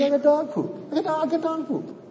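high, laughing exasperation; incredulous laughing complaint; laughing disbelief. Real, not acted. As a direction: laugh out the outrage. Exasperated complaint about dog poop.

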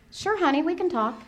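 high and soft, forced calm; calm sweet reply. Retold or imitated. Mother answering her son with put-on calm, soft and high. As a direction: hide the panic with sweetness. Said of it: "what comes out of my mouth is".

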